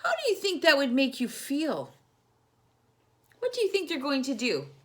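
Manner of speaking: ordinary delivery, nothing marked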